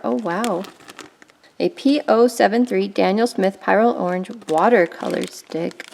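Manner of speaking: ordinary talk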